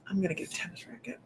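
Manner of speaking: quick whisper under her breath; whispered aside. Real, not acted. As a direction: rush it out in a whisper. Muttered aside during readings.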